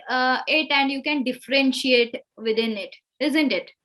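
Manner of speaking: normal talking voice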